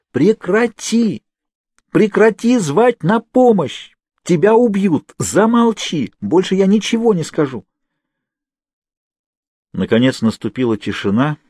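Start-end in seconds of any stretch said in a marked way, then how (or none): 0.1-7.6 shout with all her might. A narrator's reading. Naomi shouting at Kate to stop calling for help. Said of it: "Наоми крикнула, что есть сил".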